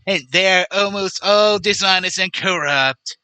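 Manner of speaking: put-on politician impression; Donald Trump. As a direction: do a mocking impersonation of the former president. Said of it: "let me tr-, try this again".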